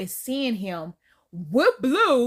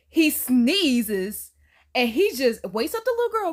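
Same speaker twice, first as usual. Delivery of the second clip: ordinary talk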